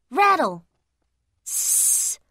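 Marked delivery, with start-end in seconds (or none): 1.4-2.2 soft breathy cat hiss through teeth. A narrator's reading. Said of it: "hissed Cobweb".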